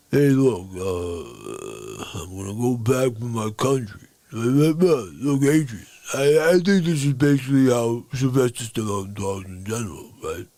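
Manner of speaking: low, mumbly Stallone; Stallone impression. Rambo speaking to Adrian in a low Stallone mumble. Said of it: "John Rambo was got like".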